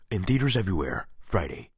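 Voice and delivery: epic trailer sign-off; movie trailer guy voice. Acted. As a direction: land the release date like a trailer. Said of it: "my movie trailer guy impression".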